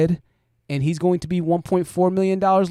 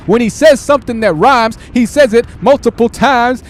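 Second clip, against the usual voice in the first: "says" belted louder and higher, right into the mic; a loud, higher parody of a streamer clipping his mic; mocking mic-blasting yell; 5 semitones higher, 7 dB louder than usual. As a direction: shout it like he's eating the microphone. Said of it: "gets real close to the mic to where the mic starts clipping".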